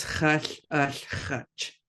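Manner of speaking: normal talking voice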